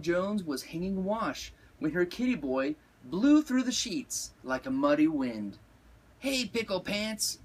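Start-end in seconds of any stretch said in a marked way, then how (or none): 6.2-7.4 Mama cat calling out, raised pitch; mild holler, voice lifted higher. A narrator's reading. Mama calls to her kitty boy, hollering lightly. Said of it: "hollered Mama".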